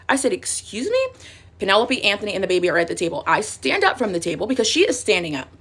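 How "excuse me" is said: high, softer rising question; mock indignant disbelief. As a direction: go way up, as if offended. Acting out her offended response.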